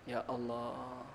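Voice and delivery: barely audible, low murmur; hushed sighed invocation. Real, not acted. Preacher calls on God after warning about prayer, hushed. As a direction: let it out very softly and low.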